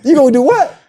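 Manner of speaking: high incredulous question; acted astonishment, pitch jumping up. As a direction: react in mock disbelief. Acts out incredulous reply to David.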